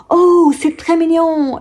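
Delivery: high, drawn-out gushing English lady; English accent. Retold or imitated. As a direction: coo it slowly in an imitated English accent. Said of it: "elle avait un accent anglais".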